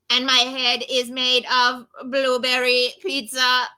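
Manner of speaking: even, monotone robot delivery; robot monkey. Acted. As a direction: keep every word on one note. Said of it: "I am a robot monkey".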